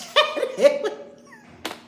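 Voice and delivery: loud, high cackling laugh; real laughter. Real, not acted. Host recalling a thirteen-hour experience, laughing hard.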